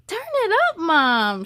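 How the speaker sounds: high, eager squeal of delight; excited child. Acted. Girl in the car begging her mom, squealing with excitement. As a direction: beg mom to crank the radio, thrilled. Girl hears favorite song.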